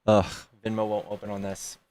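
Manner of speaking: ordinary delivery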